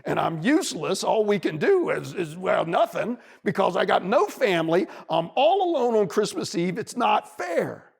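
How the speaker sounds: loud, high wailing complaint; crying bunny. Acted. Bunny wails to the wolf, loud and tearful. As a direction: bawl it out like a hurt child. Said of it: "he starts crying again".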